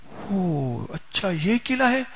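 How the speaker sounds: drawn-out soft oh of discovery; slow realization. Retold or imitated. Servant realizing he can open the fort gate, slow and soft. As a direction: let it dawn on you slowly.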